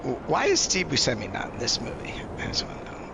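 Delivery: hushed whisper aside. Real, not acted. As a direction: whisper it under your breath. Sudden aside whispered to group.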